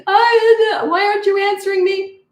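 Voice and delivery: very high, loud mock toddler scream; screaming tantrum. Toddler screaming at parent who ignores them, mock tantrum. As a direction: wail it like a child mid-tantrum, hammed up. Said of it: "If they're screaming and having a tantrum".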